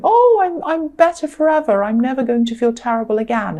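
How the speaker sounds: high, gushing voice of an overjoyed heart; mock elation. Reader voicing the listener's heart during the ups, in mock elation. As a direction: start very high, playfully over the moon. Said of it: "your heart might be going".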